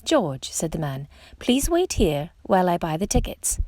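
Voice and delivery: ordinary delivery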